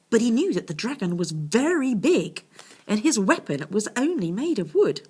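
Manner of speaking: plain reading voice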